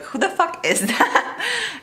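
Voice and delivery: high, slow, laughing who-is-that; laughing disbelief. Retold or imitated. Vlogger retelling meeting a forgotten character, laughing. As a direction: laugh through the baffled question. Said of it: "and I was like".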